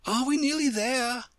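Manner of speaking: high, strained little question; panting. A narrator's reading. Alice running behind the Queen, mildly panting question. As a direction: ask it high, as if short of breath. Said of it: "managed to pant out at last".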